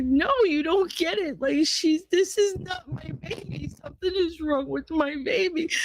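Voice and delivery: very high, strained, panicked plea; desperate pleading. Retold or imitated. Mother begging staff that something is wrong with her baby, desperate. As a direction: plead in rising desperation to be believed.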